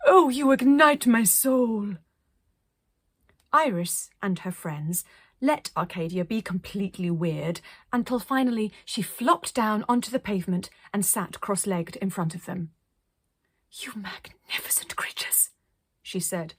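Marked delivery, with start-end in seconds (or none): 0.1-2.0 louder, slow and grand; rapturous declaration. Acted. Arcadia praises the children, loud and slow. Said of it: "saying things like". 13.7-15.5 soft breathless whisper; awed whisper. Acted. Arcadia sits before the children, awed whisper.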